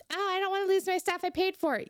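high, fast whiny complaint, imitating; mock petulant child. Podcaster mocking players who complain about vaulting, petulant child voice. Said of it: "petulant children ... just shouting about".

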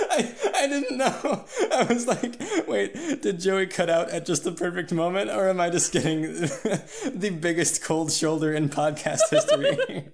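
high-pitched laughing while talking; laughing through the words. Real, not acted. Laughing at sudden silence.

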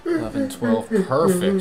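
high, loud triumphant count; excited. Real, not acted. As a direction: land it with a cheer.

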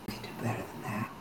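hushed whisper in the ear; soft, fast, secretive whisper. Acted. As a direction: whisper it, low and quick. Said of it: "you hear the whisper in your ear".

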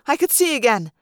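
usual speaking voice

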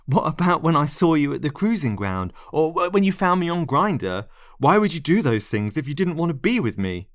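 desperate protest. Acted. Narrator confronts departing lover with evidence, desperately protesting. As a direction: demand an answer, pleading and hurt.

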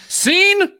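high, theatrical cry of "Scene?"; mock dramatic director. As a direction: ham it up like an arty film director. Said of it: "It's like".

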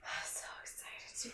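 hushed, whispered aside; excited whisper. Real, not acted. Looking forward to Wizarding World, excited whisper. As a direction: whisper it, full of anticipation.

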